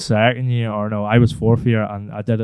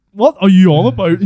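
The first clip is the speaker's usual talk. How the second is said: indignant; 8 semitones higher, 7 dB louder than usual. Retold or imitated. Indignant denial to teacher.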